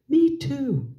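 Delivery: put-on children jumping in with excitement; eager kids. A narrator's reading.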